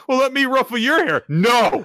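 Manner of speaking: high, loud acted back-and-forth; mock outcry. Podcaster acting out a hair-ruffling squabble, mock outcry. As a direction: pitch way up, then blurt the refusal. Acted teasing request and refusal.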